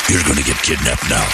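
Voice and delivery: low mock-ominous crone; lightly voiced spooky old woman; old witch. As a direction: growl it like a storybook witch. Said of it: "ninety-eight-year-old witch".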